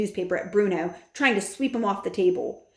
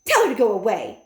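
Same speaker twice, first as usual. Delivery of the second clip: louder dismissive order; snapping wife; 3 semitones higher, 6 dB louder than usual. A narrator's reading. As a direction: wave her off loudly. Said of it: "said Missus Jenkins".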